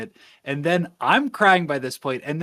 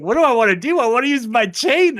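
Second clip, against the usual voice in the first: high, loud acted-out outburst; excited retort; 10 semitones higher, 8 dB louder, faster than usual. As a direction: blurt it out high and emphatic. Acting out a character's excited answer.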